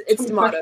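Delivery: "tomato" with a broad "ah", to-mah-to; British pronunciation. Shown as a demonstration. Correcting a friend, "tomato" said to-mah-to. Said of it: "that's how you say it".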